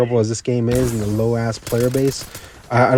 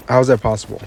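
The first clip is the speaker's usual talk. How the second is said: ordinary delivery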